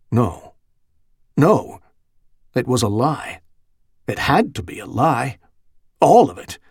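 short bursts of denial, rising to an emphatic peak; anguished denial. A narrator's reading. Terrified priest's thoughts rejecting what he heard, anguished disbelief. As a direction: refuse to believe it, with a touch of terror. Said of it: "whiplashed by terror and anguish".

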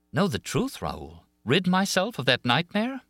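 high, rising disbelief; incredulous echo. A narrator's reading. Christine repeats Raoul's question back to him, incredulous.

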